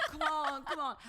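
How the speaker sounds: high, loud, laughing urging; laughing mock impatience. Urging a future partner to hurry up, laughing mock plea.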